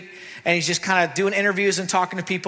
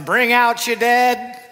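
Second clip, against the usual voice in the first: slow, louder call of a cart man; cart man's call; 5 semitones higher, 4 dB louder, slower than usual. Retold or imitated. Preacher imitating Monty Python cart man, slow call. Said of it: "it's going".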